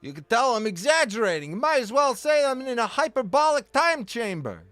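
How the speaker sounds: a bright overplayed joking lilt; playful exaggeration. Streamer joking to chat about hyperbole, high hammed-up voice. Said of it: "I'm being hyperbolic".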